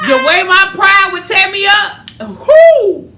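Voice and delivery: loud, high laughing outcry; loud high whoop with laughter; laughing exclamation. Real, not acted. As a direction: let the pride burst out in a whoop. Pride tearing her up.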